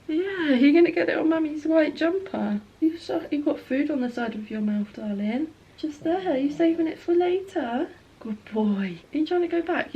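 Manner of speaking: high, sing-song pet voice. Real, not acted. Owner fussing over her dog Boz, cooing.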